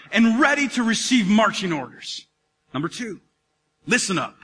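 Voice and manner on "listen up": normal talking voice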